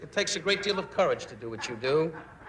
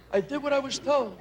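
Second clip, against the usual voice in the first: higher, lightly voiced wise guy; 9 semitones higher than usual. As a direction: say it like a cornered mobster. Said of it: "he's in Salerno going".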